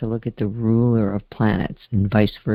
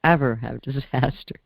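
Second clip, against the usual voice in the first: usual speaking voice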